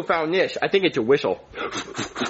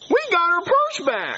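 triumphant cheer; 4 semitones higher than usual. Acted. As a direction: celebrate like cartoon heroes. Heroes celebrate their victory.

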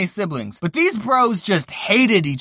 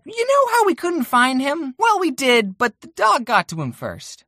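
mom's voice; 6 semitones higher than usual. Retold or imitated. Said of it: "She said".

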